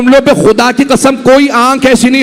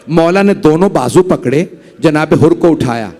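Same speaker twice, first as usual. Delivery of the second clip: ordinary talk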